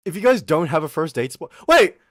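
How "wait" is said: loud, high sudden stop; sudden shout. Real, not acted. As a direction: yell to stop himself. Cuts himself off suddenly.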